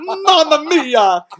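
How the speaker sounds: put-on very slow, high, loud Mamma Mia in an Italian accent; Italian Mario accent. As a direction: cry it out like a cartoon Italian. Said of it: "we're gonna go full fucking Mario".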